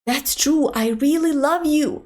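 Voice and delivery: usual speaking voice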